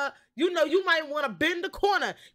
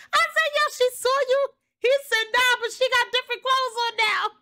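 excited retelling; 6 semitones higher, 4 dB louder than usual. Retold or imitated.

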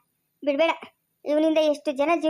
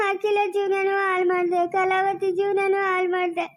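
tearful lament; 2 semitones higher than usual. Acted. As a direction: wail it out at the man who betrayed you. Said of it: "ಅತ್ತೆ, ಅಳ್ಬೇಡಿ". (ಅಳುತ್ತಾ ಗೋಳಾಡುವ ಧ್ವನಿ)

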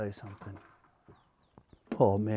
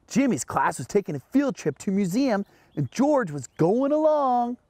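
put-on reading to children; 6 semitones higher than usual. A narrator's reading. Host reading Curious George to children, lilting storytelling. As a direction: read it to little kids with big swoops. Reads picture book to children.

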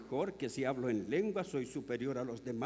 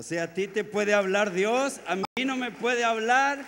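higher, loud, building protest; indignant comeback, pitched up and loud; loud indignant retort; 9 semitones higher, 10 dB louder than usual. Retold or imitated. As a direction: throw it back at him, rising to a shout. Punchline retort to the man.

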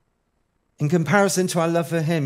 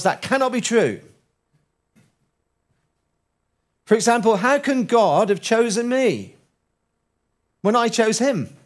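doubting believer; 7 semitones higher, 5 dB louder than usual. Pretend.

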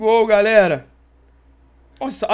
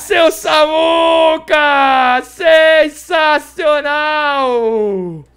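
loud, slow, drawn-out cheer; excited celebration; 4 semitones higher, 5 dB louder, much slower than usual. Real, not acted. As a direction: stretch each word in triumph. Celebrating a save. (comemoração empolgada)

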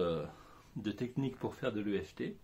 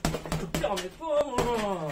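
angry outburst; 9 semitones higher, 6 dB louder, slower than usual. Acted. Angry outburst opening sketch.

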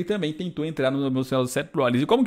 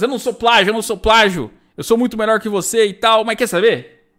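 high, loud mock protest; acting out a riled-up denial; indignant protest; 8 semitones higher, 5 dB louder than usual. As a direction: protest hotly, as if stung by the insult. Acting Seth's indignant retort to insult.

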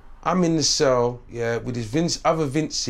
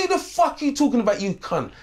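angry comeback; 7 semitones higher than usual. Retold or imitated. Retold angry comeback in the cell.

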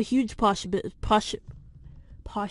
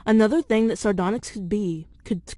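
script reading; 5 dB louder than usual. Acted. Reads theory script aloud.

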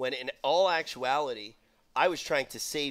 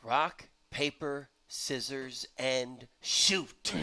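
dramatic countdown; much slower than usual. Acted. Commentator opens another match, dramatic countdown.

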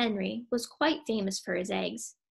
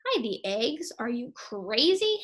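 mock outrage. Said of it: "Henry blazed".

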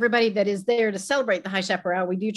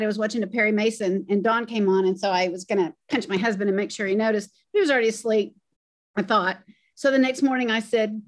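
ordinary talk, nothing marked

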